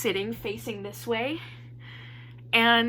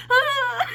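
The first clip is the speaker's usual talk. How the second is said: high, loud imitated sob; mock bawling wail; 12 semitones higher, 5 dB louder than usual. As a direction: wail like a hammed-up crier. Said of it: "I'm bawling".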